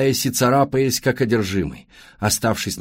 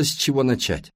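ordinary delivery, nothing marked

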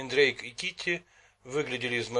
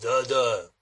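thunderous voice; 5 dB louder than usual. A narrator's reading. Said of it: "громовой голос Ноуда".

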